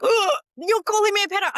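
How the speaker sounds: high, loud indignant mock outrage; offended adult. Adult accused of grooming, high loud mock indignation. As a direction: play the adult taking huge offence. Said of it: "they would be like".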